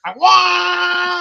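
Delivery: imitated buzzing wail. Buddy acting out the vibrator's third speed, loud shout. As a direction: mimic the toy going buck wild.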